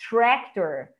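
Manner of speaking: TRACTOR said "TREK-tor". Shown as a demonstration. Teacher introducing the next example, E sound in tractor. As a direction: say TRACTOR with an open "eh". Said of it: "tá com E".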